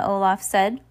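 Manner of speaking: ordinary delivery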